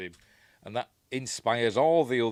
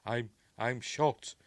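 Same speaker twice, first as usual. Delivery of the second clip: stunned "I'm shot"; 3 semitones higher, slower than usual. Retold or imitated. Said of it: "Is that a reference to Westworld".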